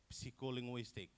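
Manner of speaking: psycholinguistic with the p sounded, p-see-ko; students' mispronunciation. Retold or imitated. Presenter imitates students reading every letter, sounded p. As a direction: start psycholinguistic on p-s, then see. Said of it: "They pronounce all of, all of the letter there".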